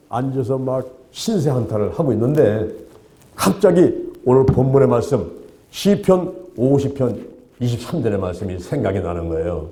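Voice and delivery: normal talking voice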